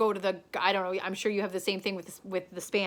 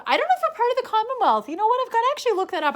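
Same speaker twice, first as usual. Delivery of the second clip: high, loud, rushed self-interruption; sudden flustered doubt; 8 dB louder, much faster than usual. Real, not acted. Vlogger doubting her own Commonwealth fact, flustered high blurt.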